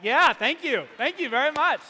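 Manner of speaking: loud, proud thank-you to nobody; a hammed-up victory shout after fixing the furnace; triumphant cheer. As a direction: crow about the small win. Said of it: "I got back up and I was like".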